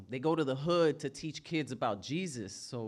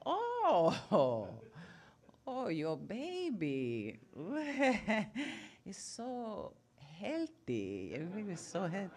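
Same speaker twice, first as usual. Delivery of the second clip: higher, slow fake-polite grandma compliment; fake sweet grandma; 4 semitones higher, slower than usual. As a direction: gush over the baby with false sweetness. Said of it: "she would be like".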